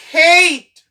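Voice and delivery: "Hate" with A said as "ay"; long A. Shown as a demonstration. Teacher shows how added E changes sound, long A. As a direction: punch "Hate" high and falling, long A. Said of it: "Son an vin chan".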